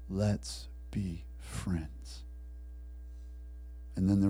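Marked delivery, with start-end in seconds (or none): 0.1-2.2 very slow, soft, low offer; gentle persistence. Acted. Prisoner answers the jailer's last refusal, softly persistent. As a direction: offer it quietly, a pause after each word.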